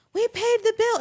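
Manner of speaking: loud, high indignant mom. Retold or imitated. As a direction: protest it loud like a wronged mom. Mom answers the prank voicemail.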